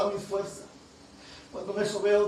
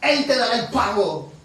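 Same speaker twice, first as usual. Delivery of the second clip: full-force preaching; 3 semitones higher, 8 dB louder than usual. Acted. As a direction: build the sermon to a loud climax. Preaching echo of promise.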